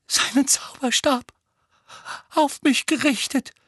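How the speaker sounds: put-on high, halting boy voice; murmelnder Junge. A narrator's reading. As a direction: voice a stricken boy in halting bits. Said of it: "murmelte Dudley". (hohe, stockende Jungenstimme)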